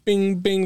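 high, quick notification bings; imitated app pings. Showing friends how likes keep popping up, imitated high pings. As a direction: mimic a phone pinging.

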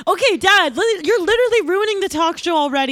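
put-on whiny teen outburst; exasperated teen snapping at dad; angry.

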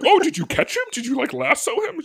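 mock your own dumb eagerness in a high voice; clueless idiot self. Son-in-law asking about the escaped bull, as a clueless idiot. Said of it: "idiot me's like".

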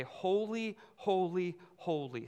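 "Holy" said three times, high first and stepping down; measured triple "Holy" of praise; angels' praise. Acted. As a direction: let each "Holy" stand apart, descending. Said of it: "angels who are with God to say".

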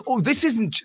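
ordinary talk

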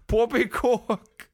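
high, slow hammed-up Cockney "Poppycock"; mock Cockney British. Mocking Tom Holland's supposed real voice, high slow Cockney accent. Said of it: "[name]'s impression of a Cockney British accent".

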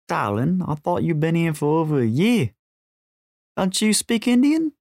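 broad Australian, questions swinging up high; thick Australian accent. A narrator's reading. James needling Jess in a tuk-tuk, thick Australian accent. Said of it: "through a thick Australian accent".

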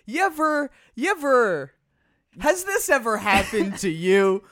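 high, loud infomercial pitch with a laugh; hammy late-night TV salesman; infomercial host. Pretend. Infomercial opener for tokens.

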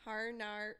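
soft, failed Northern Irish "Har nar" with hard R; botched Northern Irish. Pretend. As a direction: try Northern Irish, landing on R sounds. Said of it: "Northern Irish accent".

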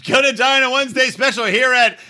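high, hammed-up salesman hawking a bargain; cheesy sales pitch. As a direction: sell the combo deal like a pushy shopkeeper. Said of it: "Is that the pitch?".

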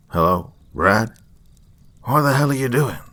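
dad barks down the phone, alarmed; lightly voiced dad. A narrator's reading. Dad answering his son's late call, loud and baffled.

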